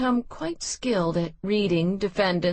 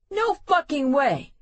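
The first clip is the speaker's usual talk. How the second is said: higher-pitched flat refusal; angry refusal; 8 semitones higher, 3 dB louder than usual. Acted. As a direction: spit the refusal out. Quinn refusing the deal.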